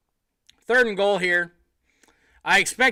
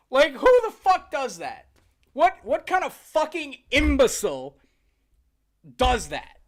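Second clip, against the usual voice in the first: exasperated rant; 6 semitones higher, 5 dB louder than usual. Real, not acted. Ranting at the team's play.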